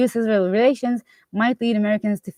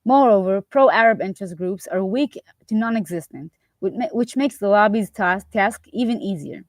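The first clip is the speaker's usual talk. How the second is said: normal talking voice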